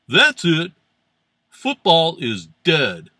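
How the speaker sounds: loud, emphatic mock pronouncement; mock outburst. Host quoting Eric's anthem protest response, loud mock outburst. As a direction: declare it loudly as hammed-up outrage.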